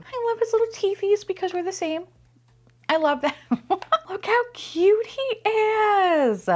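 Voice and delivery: besotted, squeaky adoration; gushing coo. Real, not acted. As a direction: coo way up high, stretching the words. Cooing over toy.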